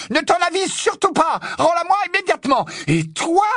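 very high shouted orders; fureur suraiguë. Acted. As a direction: shriek commands, then round on him. Hermione continue de hurler. (ordres hurlés, voix suraiguë)

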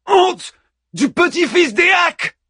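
very high, climbing indignant cry; peak outrage. Acted. Achilles escalates.

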